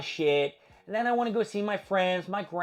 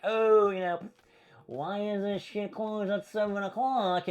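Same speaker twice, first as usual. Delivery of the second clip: higher, slowed-down mock question; snarky comment; 4 semitones higher, slower than usual. Gym member quizzing staff on early closing, snarky. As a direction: needle them with a drawn-out, snarky why. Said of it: "like, a little snarky comment".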